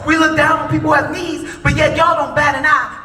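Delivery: loud, driving preacher's fire; preaching at full force. Acted. Speaker condemning war spending to the crowd, preaching at full force. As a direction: hammer it to the crowd.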